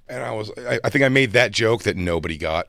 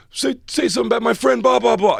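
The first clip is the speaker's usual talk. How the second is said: high, fast mocking heckler voice; mock a drunk heckler, pitched way up; 12 semitones higher, 3 dB louder than usual. Ski trip crowd heckling the comic, high mock voice. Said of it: "they were like".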